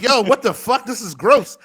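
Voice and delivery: a loud, high yelp of disbelief; disgusted reaction. Retold or imitated. Reacting to a friend's gross rap, mock disgusted outburst. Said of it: "And I'm like".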